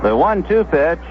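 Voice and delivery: normal talking voice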